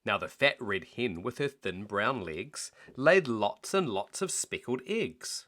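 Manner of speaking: usual speaking voice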